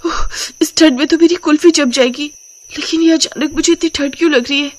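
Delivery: high-pitched shivery gasps and words; shivering with cold. Acted. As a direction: shiver and puff through the words, freezing. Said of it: "ठंड के मारे कांपने लगती है". (ठंड से कांपती ऊंची आवाज़)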